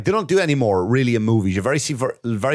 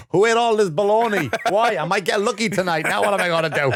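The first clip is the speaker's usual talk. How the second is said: high, hammed-up dad whining about bologna; mock dad complaining; 11 semitones higher than usual. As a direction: play the frantic father, pitched up. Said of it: "He's like".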